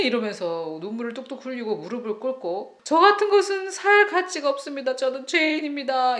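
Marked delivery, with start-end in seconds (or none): 2.8-6.2 high, loud mock self-abasing plea; tearful pleading. Kneeling child begging his mother, high loud tearful mock plea. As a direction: beg as if unworthy to live.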